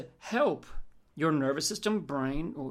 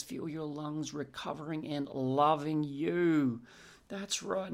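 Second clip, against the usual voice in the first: guided visualisation; 3 dB quieter than usual. Acted. Guiding a future pace.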